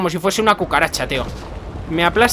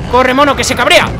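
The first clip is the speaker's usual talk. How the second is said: higher, louder urgent call; raised-voice hurry-up in a game; urgent urging; 5 semitones higher, 7 dB louder than usual. Real, not acted. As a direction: urge your character to flee. Urging game character to flee.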